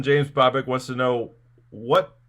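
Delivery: ordinary talk, nothing marked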